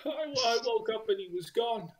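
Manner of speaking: a desperate little man begging a dragonborn for help; panicked father NPC. Acted. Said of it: "He looks up at you and goes".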